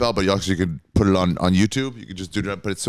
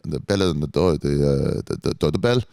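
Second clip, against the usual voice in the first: put-on lower, halting Israeli accent; Esti; 5 semitones lower than usual. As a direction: stumble toward the word in an Israeli accent. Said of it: "Yeah, that, that was good".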